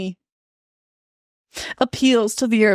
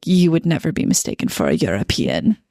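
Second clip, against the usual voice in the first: lightly voiced disdainful commenter, lower pitch; mock a European putting you down; imagined snooty online critic; scornful European commenter; 3 semitones lower than usual. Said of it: "it's gonna be a European that watches it".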